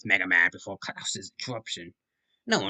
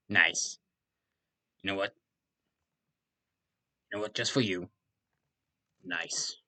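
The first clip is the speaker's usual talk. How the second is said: ordinary delivery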